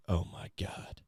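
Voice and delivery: barely voiced hushed awe; awestruck whisper. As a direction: whisper it as if stunned by momentous news. Acted awestruck reaction to reveal.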